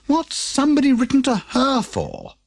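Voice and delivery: a hint of a worried postman; lightly voiced postman. A narrator's reading. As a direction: wonder who'd write to her. Postman grumbles.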